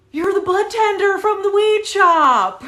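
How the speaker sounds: very high, loud exclamation; imitated amazed outburst, far above normal pitch; astonished recognition. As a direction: shout it as you suddenly recognize someone. Man suddenly recognizes her, after gasp.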